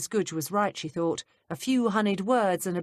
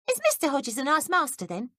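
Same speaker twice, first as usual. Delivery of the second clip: put-on higher, curious young maid; higher maid's voice, prying; 9 semitones higher, 3 dB louder than usual. A narrator's reading. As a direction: play the nosy young maid, pitched up. Betsy voiced by reader.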